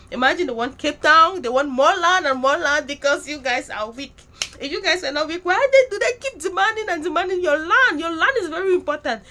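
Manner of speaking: loud, heated appeal to the listeners; impassioned rant. Real, not acted. Warning South Africans about their land, loud impassioned rant.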